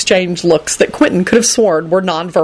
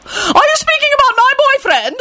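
very high screeching lady. A narrator's reading. Lady Fanshawe defends her boyfriend, screeching. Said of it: "Lady Fanshawe screeched".

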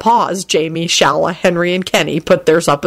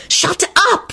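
high, urgent hushing; furious shush without a true whisper; furious hiss; 13 semitones higher than usual. A narrator's reading. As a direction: cut them off sharply, pitch raised. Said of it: "Rosie whispered furiously".

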